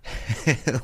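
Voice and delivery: short real chuckle; amused chuckle. Real, not acted. Host reacting to the replayed voice, amused chuckle. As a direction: chuckle lightly before speaking.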